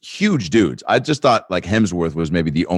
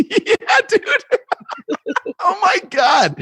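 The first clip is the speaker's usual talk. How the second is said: laughing shout; slower than usual. Real, not acted. Laughing at the big fan.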